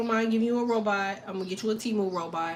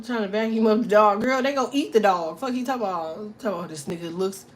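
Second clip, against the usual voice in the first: ordinary talk, nothing marked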